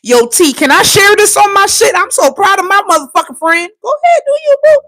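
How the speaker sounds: high-pitched gushing support, retold; proud friend. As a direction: imitate a friend hyping her up. Voices her proud childhood friend.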